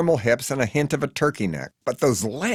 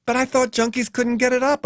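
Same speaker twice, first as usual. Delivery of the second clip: much higher, timid objection; weak argument; 14 semitones higher than usual. A narrator's reading. The hitchhiker objects weakly to Faye, high and timid. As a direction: argue without conviction. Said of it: "I weakly argue".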